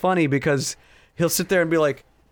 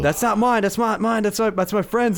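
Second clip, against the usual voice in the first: higher-pitched mock excuses; protesting boyfriend; 6 semitones higher than usual. Imitating the pranked girlfriend's boyfriend denying it, mock protest. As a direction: plead innocence, lightly hammed up. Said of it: "he's just like".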